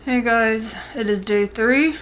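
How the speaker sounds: speaking through wired teeth; talking with mouth closed. Real, not acted. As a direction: talk with jaw wired shut. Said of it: "Just very hard to talk".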